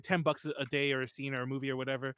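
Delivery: ordinary talk, nothing marked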